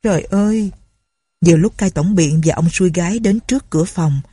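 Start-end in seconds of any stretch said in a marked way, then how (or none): 0.0-0.7 cry of dismay. A narrator's reading. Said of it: "Con lại kêu lên".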